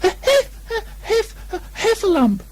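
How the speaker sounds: very high gasping cries; a little pig panting out the word; high breathless stammer; breathless Piglet. A narrator's reading. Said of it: "breathing so hard that he could hardly speak".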